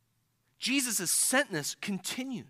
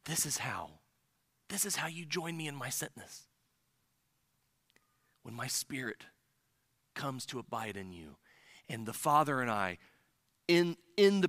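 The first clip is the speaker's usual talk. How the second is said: softer, hushed and low; soft intimate quote; 6 semitones lower, 5 dB quieter than usual. Retold or imitated. Jesus telling disciples how they join him, hushed. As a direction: speak it gently, close to the listener. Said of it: "when he says".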